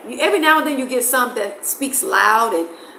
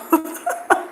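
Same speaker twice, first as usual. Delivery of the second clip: breathy laugh; 7 semitones higher, 3 dB quieter than usual. Real, not acted. Amused at the contrast.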